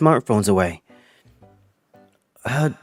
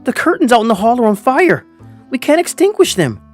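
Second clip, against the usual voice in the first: high, urgent plea; pleading; 6 semitones higher, 3 dB louder than usual. A narrator's reading. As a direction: plead to be believed, pitch climbing. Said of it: "I kept pleading with them".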